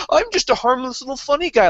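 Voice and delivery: high, sweet fake-harmless tone; squeaky innocent act; mock innocent voice. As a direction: play the harmless little guy. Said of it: "be like".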